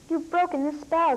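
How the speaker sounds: happy and loud, voice lifting; joyful cry. Acted. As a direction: cry out with delight. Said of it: "Kir cried".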